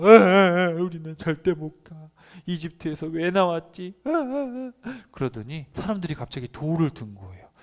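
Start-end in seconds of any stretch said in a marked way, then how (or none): 0.0-4.7 louder, higher blubbering wail; mock wailing. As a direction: bawl like a whiny child. People wailing in despair.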